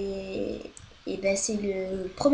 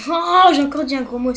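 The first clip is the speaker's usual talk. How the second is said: exasperated outburst; 5 semitones higher, 10 dB louder than usual. Real, not acted. Caught himself swearing again.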